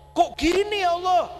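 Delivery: high, loud whiny complaint to God; a protesting cry of "Kok gini ya Allah?"; mock complaint. (keluhan protes yang dibuat-buat, nada tinggi)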